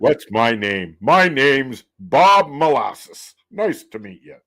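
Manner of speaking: car salesman voice. Acted. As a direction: greet like a cheesy car salesman. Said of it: "that was my car salesman voice".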